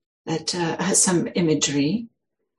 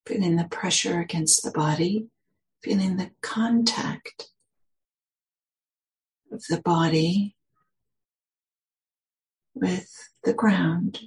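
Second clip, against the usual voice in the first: slow, measured meditation guidance with long pauses; guided meditation. Real, not acted. Meditation teacher settling listeners into their posture, guided meditation. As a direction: guide listeners gently into the body, unhurried.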